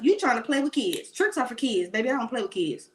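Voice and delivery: ordinary delivery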